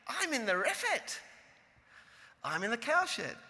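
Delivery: proud. Retold or imitated. Student telling her teacher her kibbutz job, proudly. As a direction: announce it with mock pride. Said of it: "she says proudly".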